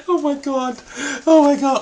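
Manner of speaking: high, gasping recovery from real laughter; worn out laughing. Real, not acted. Host recovers after seeing the face, weak with laughter. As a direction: come down from a laughing fit. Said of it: "I died laughing".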